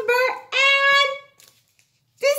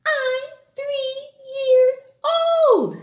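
put-on high, slow singsong child; 12 semitones higher, slower than usual. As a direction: sing it like a little kid.

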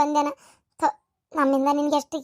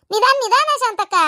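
high, raised warning call; urgent higher-pitched call; calling out caution; 6 semitones higher, 4 dB louder than usual. Acted. Calling caution after hurrying woman.

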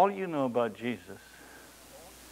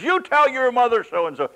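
angry father; 11 semitones higher, 9 dB louder than usual. Retold or imitated. Parents quarrel at breakfast.

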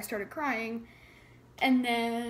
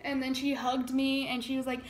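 ordinary delivery

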